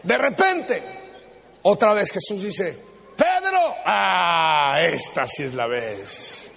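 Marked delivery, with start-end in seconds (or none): 3.2-3.8 high-pitched call of a name. Acted. Jesus calls Peter a third time, calling out. 3.9-6.5 low, drawn-out aside; knowing anticipation. Acted. Peter sure the scolding is coming, slow knowing aside.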